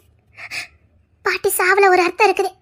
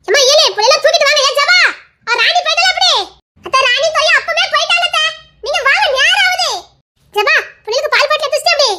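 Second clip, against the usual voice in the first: raised, higher, quicker call to the house; 6 semitones higher than usual. Acted. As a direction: hustle everyone along. Hurrying the family to leave.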